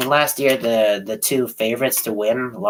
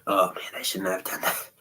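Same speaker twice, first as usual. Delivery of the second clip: strong mint. Real, not acted. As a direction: groan through a minty burn. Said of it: "never should chew on that mint".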